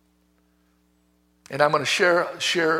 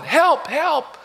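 imitated yell for help; 10 semitones higher than usual. Injured coworker calls to the only other man, mock yelling. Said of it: "he's yelling".

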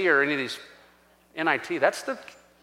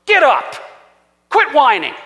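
loud, higher-pitched command; acted-out stern shout; sharp rebuke; 6 semitones higher, 6 dB louder than usual. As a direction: order a whiner to his feet. God rebukes Joshua, acted.

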